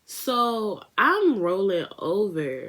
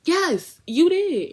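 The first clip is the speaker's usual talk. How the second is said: high, loud, indignant yes; indignant retort; 13 semitones higher, 7 dB louder, much slower than usual. Retold or imitated. Retorting to the friend who peed on her, indignant and loud. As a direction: snap back at him in disbelief.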